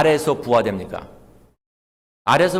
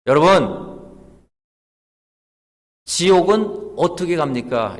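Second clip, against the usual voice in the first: raised teacherly call with long pause; evangelist questioning class; 4 semitones higher, 4 dB louder than usual. Retold or imitated. Evangelist asking Sunday school children about hell, calling out. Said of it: "전도사님이".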